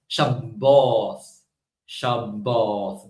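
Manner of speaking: slow, drawn-out "Shabboth" in Yemenite style; Yemenite pronunciation. Shown as a demonstration. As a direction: say "Shabboth" as shab-BAWTH, holding the b. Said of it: "the Yemenites would pronounce it as".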